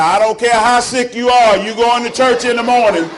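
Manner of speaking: bossy aunt shouting from far away; aunt yelling across house. Retold or imitated. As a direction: call it across the whole house. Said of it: "She was like".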